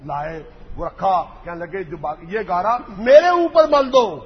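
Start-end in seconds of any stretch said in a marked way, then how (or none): 1.8-4.2 loud ridicule. Real, not acted. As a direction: jeer at him buried in mud. Scornful mud description, loud.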